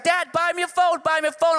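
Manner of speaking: whiny daughter begging. Retold or imitated. Comic imitating his teen daughter begging dad for a phone, high pleading whine. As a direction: beg like a fifteen-year-old.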